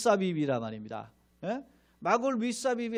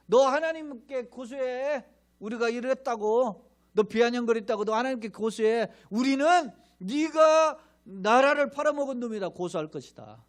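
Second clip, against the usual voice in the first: jeering taunt; 5 semitones higher, 4 dB louder than usual. Retold or imitated. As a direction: throw the challenge back at him mockingly. Crowd taunts Jeremiah.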